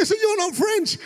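high, incredulous retold question; hammed-up amazed questioning; gleeful disbelief. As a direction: press the point in high-pitched delight. Retold gleeful questioning of his wife.

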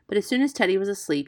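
ordinary delivery, nothing marked

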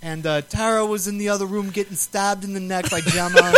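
lightly voiced bad New York accent, laughing through it; Jax Teller voice. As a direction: keep the mock tough-guy voice going. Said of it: "Jax Teller impersonation".

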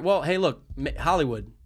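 usual speaking voice

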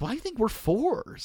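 high, slow, prim put-down; mock grandma. The grandma answering about the neighbors, high slow mock-prim reply. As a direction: mimic a grandma deflating the rating.

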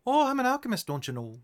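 mock Minnesotan, starting high; a playful Minnesotan singsong; Minnesotan accent. DM teasing a player with a Minnesotan accent. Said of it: "speak with a Minnesotan".

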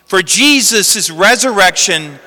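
ordinary talk

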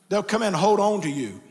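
normal talking voice

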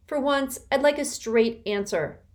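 sulky, fed-up grumble; grumbling. A narrator's reading. Constance complaining about coded messages, grumbling. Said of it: "Constance grumbled".

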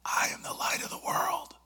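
a stronger unvoiced stage whisper. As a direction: push the whisper harder to get through. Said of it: "saying it a little bit louder".

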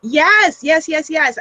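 bright, eager burst of yeses; joyful cry. Real, not acted. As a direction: cry out yes with delight. Eager agreement to fitness challenge.

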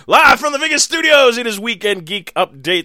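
high loud showbiz announcer. Acted. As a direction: open the show like Vegas TV. Announcer-style show open.

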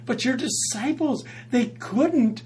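high, loud desperate plea; desperate father begging Jesus for his son, loud and high; desperate crying out. Retold or imitated. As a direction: cry out like a father at his wit's end. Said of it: "cries out to Jesus".

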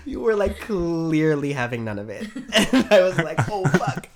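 high-pitched, talking through laughter; helpless giggles breaking into the words; real laughing. Real, not acted. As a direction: crack up while telling it. Cracking up while recalling friend's face.

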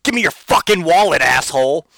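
back alley thug. Retold or imitated. Cat as a back alley thug mugging him, loud and menacing. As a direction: bark it like a street thug robbing you. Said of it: "back alley thug being like".

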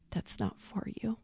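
soft, almost whispered, tender; gentle hush. Retold or imitated. Jesus gently stops Mary drinking.

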